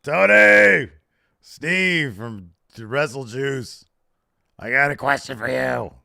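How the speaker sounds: loud, high, drawn-out holler for attention; hammed-up media-call shout; mock press-call reporter. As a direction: play an obnoxious caller shouting out a question.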